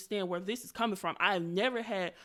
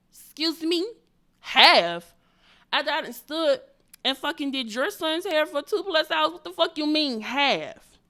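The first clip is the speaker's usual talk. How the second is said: loud, higher-pitched disbelief; raised-voice offended challenge; indignant outrage; 6 semitones higher, 9 dB louder than usual. Retold or imitated. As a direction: confront them, incredulous and fed up. Indignant at half payment.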